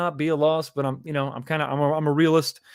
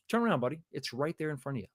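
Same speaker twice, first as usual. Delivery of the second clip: ordinary delivery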